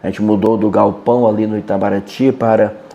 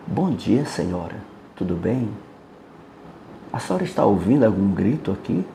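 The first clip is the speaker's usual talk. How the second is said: softer and gently held down, calm and steady; calm lowered voice; 3 semitones lower, 8 dB quieter than usual. Retold or imitated. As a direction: answer her shouting with quiet calm. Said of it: "baixei realmente o tom de voz, segurei aquele tom de voz, mas calmo, tranquilo". (voz baixa e calma, tranquila)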